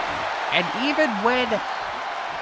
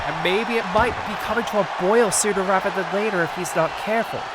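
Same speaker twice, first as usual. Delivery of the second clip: ordinary delivery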